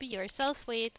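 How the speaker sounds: normal talking voice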